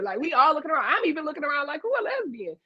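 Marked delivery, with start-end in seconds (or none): 1.8-2.6 high, softer mock bewilderment; retold puzzlement. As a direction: play clueless, voice pitched way up. Retold puzzled looking around.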